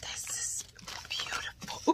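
soft breathless whisper; awed whisper. Real, not acted. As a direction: whisper in wonder.

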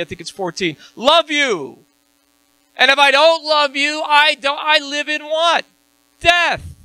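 loud, high fiery preaching; high, loud fervent sermon; preaching at full force. Acted. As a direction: drive it home like a revival preacher. Preaching climax.

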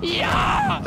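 Jubelschrei. Real, not acted. Jubel beim Entkommen.

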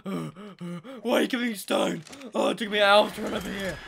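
loud, panting mock complaint; winded complainer. Speaking for the worn-out dinosaur, breathless mock complaint. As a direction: gasp it out as if worn out from running.

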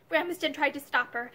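ordinary delivery, nothing marked

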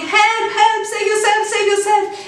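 high-pitched, urgent narrated shout; shouting for help. A narrator's reading. Narrator voices fleeing animals shouting for help, high and urgent.

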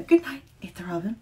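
ordinary delivery

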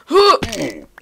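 loud, high gasp with a falling tail; startled "hoo" on a sudden drop. Real, not acted. Player falling in the game, startled gasp.

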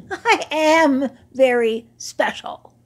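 slow, loud, puffed-up; proud boast. Acted. Old camel boasting to admirers, slow and proud. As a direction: brag to the young camels.

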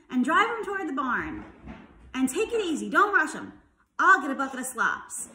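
lightly acted farmer calling instructions; farmer's orders. A narrator's reading. Farmer giving orders in story.

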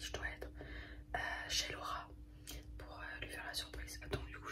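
hushed whisper so as not to wake someone. Real, not acted. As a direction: whisper softly, someone's asleep nearby. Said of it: "Je chuchote parce qu'il y a Kylian qui dort".

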